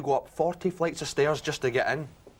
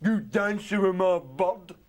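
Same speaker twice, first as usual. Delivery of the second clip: menacing skinhead; 3 semitones higher, slower than usual. Retold or imitated. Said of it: "He comes over".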